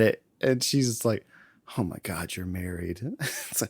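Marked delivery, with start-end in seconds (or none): 1.8-3.0 low, soft acted dismay; dropped, under-the-breath retold reaction; hushed mock realization. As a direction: imitate a quiet, sinking realization. Acted reaction to a supposed confession.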